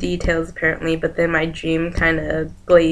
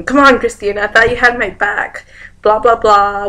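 higher, louder mock appeal; acted pleading; 4 semitones higher, 6 dB louder than usual. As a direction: appeal to a friend, imitating him. Voices friend pleading after yelling.

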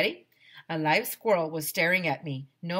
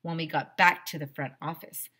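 usual speaking voice

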